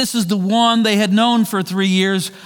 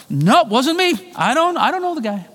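nervous denial; 6 semitones higher than usual. Retold or imitated. Peter denies Jesus.

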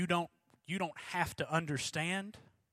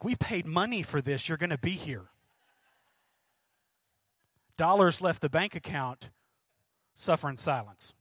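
loud, insistent parental telling-off; hammed-up no-nonsense parent; mock stern parent; 3 semitones higher, 6 dB louder than usual. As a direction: lay down the law, louder and emphatic. Parents voiced, mock scolding.

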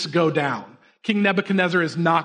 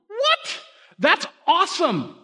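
high-pitched incredulous "what?", then awed; amazed; 10 semitones higher, slower than usual. Real, not acted. Preacher marvels at the teenagers' bold reply, amazed. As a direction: react in delighted disbelief, voice leaping up.

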